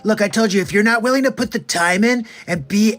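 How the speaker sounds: loud hyped motivational voice; Gary V impression. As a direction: pump them up. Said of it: "Gary V".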